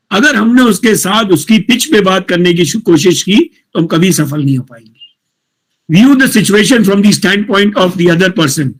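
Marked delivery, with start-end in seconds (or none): none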